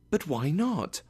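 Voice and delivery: high, puzzled protest; pleading question. A narrator's reading. Nephew pleads after refusal.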